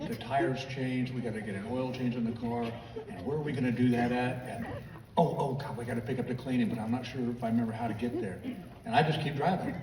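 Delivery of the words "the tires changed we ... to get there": quiet running to-do list mumbled under the breath; wife thinking aloud while he drives; Gail muttering to herself. Retold or imitated. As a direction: ramble the chores softly, thinking aloud. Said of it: "So I hear this".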